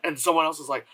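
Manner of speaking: normal talking voice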